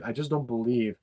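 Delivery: usual speaking voice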